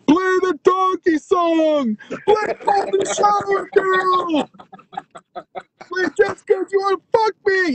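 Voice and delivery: high, slow, stretched-out calls from the back; drawn-out heckle. Retold or imitated. Security guys at the back calling out requests, high and slow. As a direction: yell requests across the room, high and slow.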